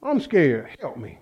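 soft admission fading almost away; small, helpless confession. Retold or imitated. Preacher admitting fear to God, helpless confession. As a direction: let the plea drop to nearly nothing.

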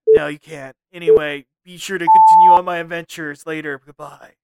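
mock-serious angst, then a showy goodbye; Reboot Watto. Watto signs off his episode to co-host, lightly voiced. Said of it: "I'm Reboot Watto".

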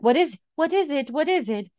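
a touch of panic in repeated questions; mock alarm. Husband carrying the baby answers his wife, mock alarm.

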